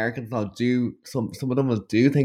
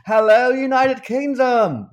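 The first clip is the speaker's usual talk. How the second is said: high, loud, drawn-out concert shout; big slow arena hello; mock stage greeting; 12 semitones higher, 5 dB louder, slower than usual. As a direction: imitate a star hailing the crowd. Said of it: "He was like".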